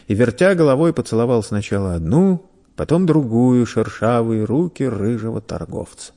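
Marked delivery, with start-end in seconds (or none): none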